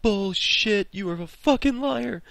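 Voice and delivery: hammed-up adults calling out a lie; mock accusing shout. Adults to kids with wild story, mock shouted disbelief.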